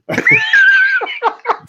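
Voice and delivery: high shrieking laughter; loud shrieking laugh. Real, not acted. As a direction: burst into a screaming laugh. Laughing at gag story.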